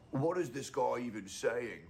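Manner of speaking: mock Russian accent, slowed down. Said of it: "I was trying to do a Russian accent".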